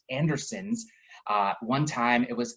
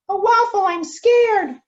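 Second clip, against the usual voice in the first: very high, loud frightened cry; scared child; 9 dB louder, slower than usual. Acted. Little Pancake cries out to Waffle, very high and scared. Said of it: "shouted Pancake".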